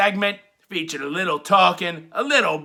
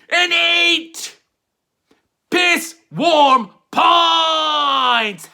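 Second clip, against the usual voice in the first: higher and louder, stretched very slowly; drawn-out shouted catchphrase; 9 semitones higher, 4 dB louder, much slower than usual. Acted. Host hamming up his rating catchphrase with a slow shout. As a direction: belt the rating out long like a ring announcer.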